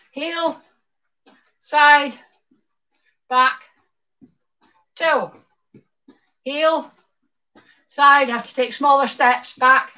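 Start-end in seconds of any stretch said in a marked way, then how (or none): 0.1-8.3 quicker cueing with shorter gaps between moves; faster step calls. Shown as a demonstration. Instructor speeding up the dance routine, faster cues. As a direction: call the steps at a brisker beat. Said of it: "You go faster".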